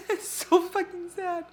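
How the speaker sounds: high, tearful half-laugh. Real, not acted. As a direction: laugh through the tears. Reacting tearfully to the book.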